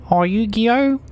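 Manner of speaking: high, exaggerated Kiwi bloke voice; hammed-up New Zealand accent. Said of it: "attempt at a New Zealand accent".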